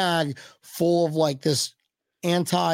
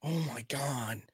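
whispered dismay; whispering; 4 semitones lower, 12 dB quieter than usual. Real, not acted. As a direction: breathe it out in a whisper. Dismay at cold forecast.

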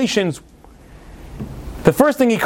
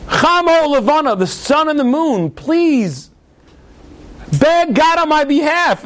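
begging plea; 7 semitones higher, slower than usual. Retold or imitated. Pleading to sun and moon.

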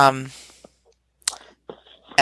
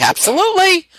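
much higher, loud and bright; eager absolutely; 7 dB louder, faster than usual. Retold or imitated. Host recalling saying yes to the guest, eager and much higher.

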